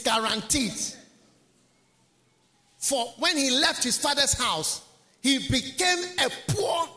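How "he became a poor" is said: preaching at full force. Acted. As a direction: cry out each word of ruin.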